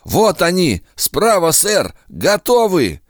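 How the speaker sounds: raspy battlefield yell, high; hoarse shout. Acted. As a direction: bark it out hoarsely. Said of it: "Это был хриплый крик".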